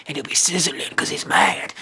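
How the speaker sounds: whispered, conspiratorial aside about the angry ghost; hushed secret whisper. Acted. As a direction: lean in and whisper it.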